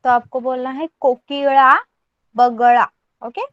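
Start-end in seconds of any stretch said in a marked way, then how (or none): 1.0-1.8 कोकिड़ा said with a flapped retroflex ळ instead of a plain ड; tongue curled back for the ळ in कोकिड़ा; retroflex ळ sound. Shown as a demonstration. Teacher to student, showing how to say कोकिड़ा with ळ. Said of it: "उसका pronunciation आपकी तरफ से ड की तरह". 2.4-2.9 बगड़ा said with the retroflex lateral ळ, not ड; retroflex ळ sound. Shown as a demonstration. Teacher to student, showing how to say बगड़ा with ळ. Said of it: "उसका प्रोनाउंसिएशन आपकी तरफ से ड की तरह".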